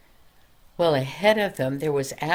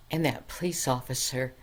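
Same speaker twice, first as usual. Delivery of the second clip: usual speaking voice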